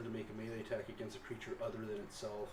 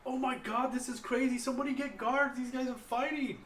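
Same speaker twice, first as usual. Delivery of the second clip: high, loud panicked shout for help; feigned alarmed yelling; 9 dB louder than usual. Pretend. Player deceives onlookers about fighting guards, feigned alarmed yelling. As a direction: raise a false alarm at full pitch. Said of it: "you kinda start yelling like".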